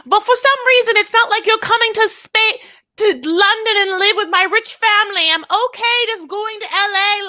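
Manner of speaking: high, loud posh British impression of a rich girl; put-on British accent. Rich girl bragging to the host in a British accent. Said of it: "It's the wrong accent".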